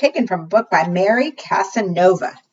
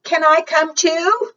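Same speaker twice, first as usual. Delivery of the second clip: much higher, pleading wolf; hopeful wolf; 10 semitones higher, slower than usual. Acted. Wolf peeking from the pines asking to come, high and eager. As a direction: ask eagerly like a pup.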